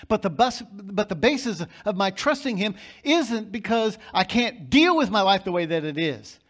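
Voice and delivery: loud, driving sermon voice, pressing every point; fiery preaching. Acted. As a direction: preach it out full force to the congregation. Preaching at full volume.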